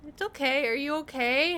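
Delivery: high soothing voice to a pet; baby talk. Retold or imitated. Talking soothingly to cat.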